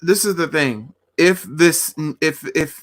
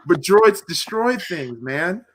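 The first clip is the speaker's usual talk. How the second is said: normal talking voice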